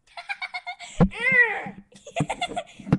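loud high-pitched cackling laugh. Real, not acted. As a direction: burst into shrieking laughter. Friends goofing on camera.

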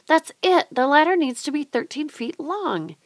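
put-on boy cracking the ladder problem, thrilled; excited eureka. A narrator's reading. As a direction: burst out with the answer.

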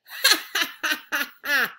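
loud giddy cackle; crazy kid laugh. A narrator's reading. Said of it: "laughing loud like a crazy".

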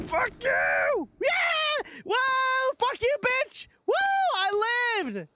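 very high, wild victory whoops; shrieking, defiant celebration; triumphant yelling. Real, not acted. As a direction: crow in relief at surviving. Survived the scare, celebrates.

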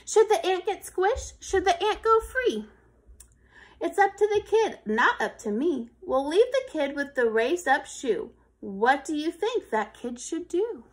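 lightly lifted storytelling read; story narration. Acted. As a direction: pose the question to listeners. Reads closing narration.

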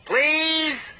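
begging. A narrator's reading. Little engine begging.

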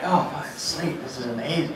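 soft, low sleepy murmur; acting half-asleep, hushed; blissfully drowsy. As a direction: sink contentedly back into sleep. Blissfully drifting back to sleep.

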